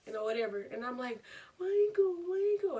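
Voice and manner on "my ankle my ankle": high, loud mock wailing; acted-out hurt whine; pained wail. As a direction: cry out in pain, hammed-up. Hurt girl cries out to brother.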